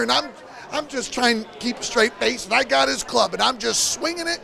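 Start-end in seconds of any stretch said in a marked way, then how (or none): none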